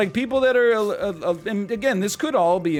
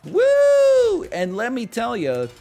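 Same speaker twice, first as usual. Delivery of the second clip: high, loud woo into a cheesy punchline; hack comic; 8 semitones higher, 7 dB louder, slower than usual. Pretend. Mock standup comic telling a corny joke. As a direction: ham up an old vaudeville joke.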